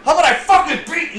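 high, loud hammed-up threat; mock furious husband. Riffing on the husband, high hammed-up shout. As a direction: spit it out like an angry thug. Cohost joins acted quarrel.

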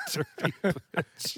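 slow, giggling repeat; laughing. Real, not acted. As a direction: say it slowly through laughter.

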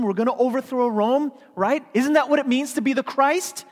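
high, loud indignant outburst; heated argument. Retold or imitated. Peter rebukes Jesus in a yelling match, heated. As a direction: yell it like Peter rebuking his leader. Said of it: "like a yelling match".

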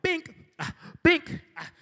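high-pitched "bink" sound effects; imitated measuring noise. Friends passing the height sign, high cartoon binks. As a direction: pop out high little binks. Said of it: "they're all just, like, going up there".

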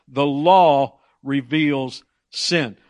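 slow emphasis. Shown as a demonstration. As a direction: lay it down word by word. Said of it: "boil it down to a simple phrase".

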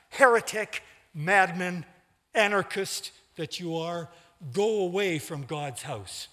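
raging denunciation. A narrator's reading. Raging pastor denounces organist.

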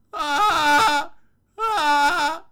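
high, loud terrified screech; panicked scream. Acted. Confused person screaming at a chair in pure panic. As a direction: shriek in total confusion.

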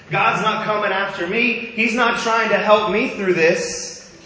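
an angry, dragged-out complaint; bitter inner cry. Retold or imitated. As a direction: let the frustration build as you protest to God. Retold angry inner thought.

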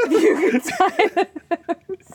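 high, slow, words stretched through laughter; real helpless laughter while talking; laughing hard. Real, not acted. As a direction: barely get it out for giggling. Joking, breaking up laughing.

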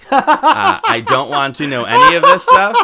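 high, loud laughing protest. Real, not acted. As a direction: laugh through the words. Laughing through refusal.

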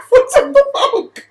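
laughing it out, pitch high and slow. Real, not acted. To friend about the Bamoke nickname, laughing. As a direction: ask through giggles.

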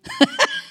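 short amused laugh; a quick real laugh. Real, not acted. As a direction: burst of laughter. Laughs at disciples' zeal.

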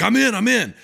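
high, loud and eager; overeager, can't wait; eager agreement. Retold or imitated. Nugent signing up for the tour, high and eager.

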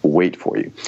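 calm, unhurried speech with long pauses; slow, deliberate pauses. Shown as a demonstration. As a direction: pause and speak with authority. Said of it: "I'm demonstrating the concepts as I'm talking about them".

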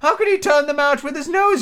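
high-pitched insistent question; persistent. A narrator's reading. Mock Turtle questioning Alice, high and persistent. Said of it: "the Mock Turtle persisted".

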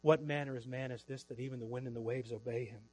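low, soft awe, retold; hushed marveling. Disciples marveling at Jesus stilling the storm, hushed awe. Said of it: "on their faces marveling".

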